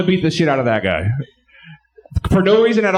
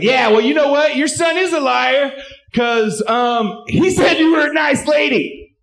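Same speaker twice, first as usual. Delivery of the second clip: panicked mock yell; 8 semitones higher, slower than usual. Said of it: "I was like".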